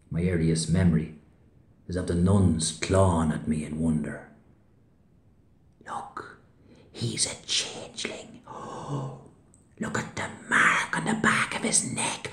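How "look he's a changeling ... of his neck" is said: hushed, horrified stage whisper; awed whisper. Acted. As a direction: whisper it in scandalised wonder. Nuns marvelling at baby.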